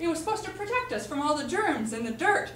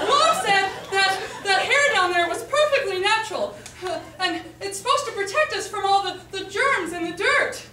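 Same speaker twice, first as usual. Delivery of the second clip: high, loud accusing protest; indignant; 4 semitones higher, 3 dB louder than usual. Acted. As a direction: throw Mom's words at your sister. Accusing sister with Mom's words.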